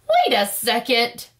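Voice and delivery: usual speaking voice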